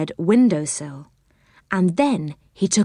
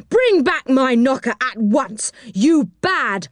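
put-on high, firm telling-off, slowing on naughty boy; angry scolding; 6 semitones higher, slower than usual. A narrator's reading. Angry little man demanding his knocker back. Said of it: "he cried angrily".

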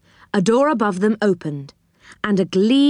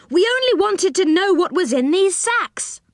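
high-pitched protest of innocence; crying out; 10 semitones higher than usual. A narrator's reading. Rick explains about the sacks, crying out. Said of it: "cried Rick".

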